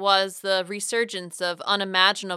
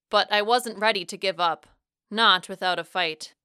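ordinary delivery

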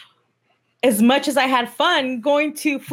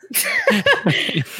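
emotional laugh. Real, not acted. Tearful thanks, breaks into laugh.

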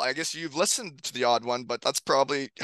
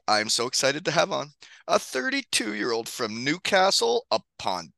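podcast intro read; 4 semitones higher than usual. Acted. Podcast host reads guest intro.

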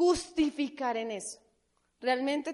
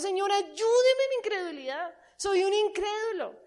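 pleading prayer; 7 semitones higher, 4 dB louder than usual. Pretend. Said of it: "tienes hoy que decirle a Dios".

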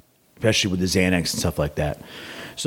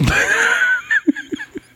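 big high laugh bursting out. Real, not acted. Laughs at doctor's advice.

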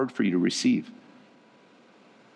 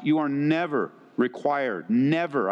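NEVER drawn out with firm stress; emphatic NEVER; slower than usual. Real, not acted. Preacher assuring wives about abuse, emphatic stress on "never". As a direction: lean hard on the word "never", slowly. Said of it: "I emphasize that word".

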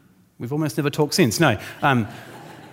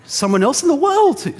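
amazed wow; 11 semitones higher, 6 dB louder than usual. Retold or imitated. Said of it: "you go".

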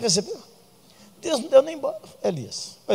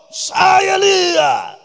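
a much higher, louder yell of the name; shouted command; 5 dB louder, much slower than usual. Retold or imitated. Pastor retelling how he shouted God's call at Elijah, loud yell. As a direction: bark the order out like a preacher scolding. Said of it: "e gritou".

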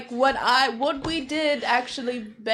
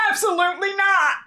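higher, mock-indignant refusal; 5 semitones higher, 4 dB louder than usual. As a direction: refuse grandly, pitch jumping up. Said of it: "Why did you sound like P J".

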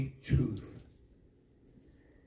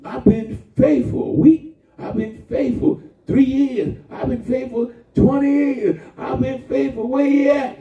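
complaining to God; 8 semitones higher than usual. Acted.

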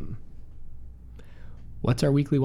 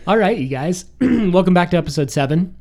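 radio voice; 5 semitones higher, 9 dB louder than usual. Acted. Restarts the show intro.

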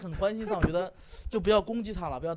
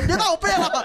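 laughing mock quote; 14 semitones higher, 10 dB louder than usual.

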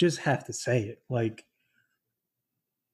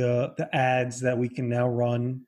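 ordinary talk

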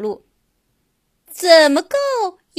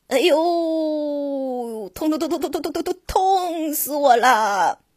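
pained giraffe. Acted. Giraffe groaning with toothache.

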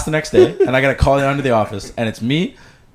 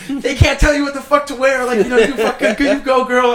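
much higher, sassy cheering voice; cheering supporters; 13 semitones higher, 4 dB louder than usual. Retold or imitated. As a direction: play the fired-up friends cheering him on. Supportive classmates quoted cheering.

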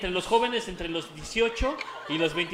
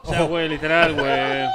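fresa voice; 4 semitones lower, 8 dB louder than usual. Acted. Showing how fresas talk.

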